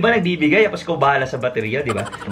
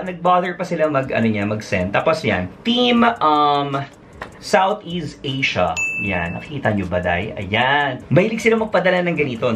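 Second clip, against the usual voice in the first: ordinary talk, nothing marked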